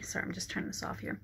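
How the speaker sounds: whispered aside. Real, not acted. Pausing the blessing to switch off a light, a fast whispered aside. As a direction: murmur it quickly off to the side.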